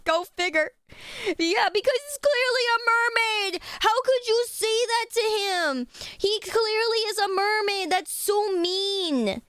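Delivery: high, slow hammed-up whimper; fake-wounded sob for the figure; mock crying. Defending the mermaid toy to chat in mock tears.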